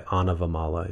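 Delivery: Anavamala said clearly as ah-nuh-vuh-MAH-luh. Shown as a demonstration. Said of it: "A N A V A M A L A".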